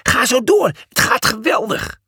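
high, eager cheering on; encouraging. A narrator's reading. Cornelius cheering Julius from below the podium, encouraging. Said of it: "moedigde Cornelius aan".

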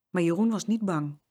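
usual speaking voice

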